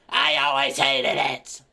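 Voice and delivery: put-on sudden loud old-lady outburst. Old lady turning on sweet chocolate, loud crabby old voice. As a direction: blurt it out slowly and loudly, crabby. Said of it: "the very old lady comes in".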